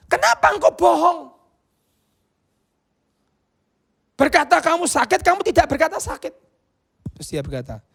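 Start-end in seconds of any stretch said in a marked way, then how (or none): 0.1-6.3 high, loud accusation; accusing. Retold or imitated. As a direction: challenge her sharply. Evangelist accusing her of lying.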